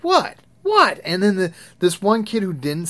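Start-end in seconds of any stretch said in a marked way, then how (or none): 0.0-1.0 very high, loud bewildered "What?"; confused kid. Retold or imitated. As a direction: squeak it like a kid who doesn't get it. Kid shocked by reaction.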